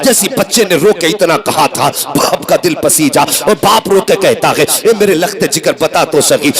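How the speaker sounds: driving, emphatic sermon storytelling; fervent Urdu preacher's delivery; impassioned preaching. A narrator's reading. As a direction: preach the tale in urgent, unbroken waves. Preaches a story at full force.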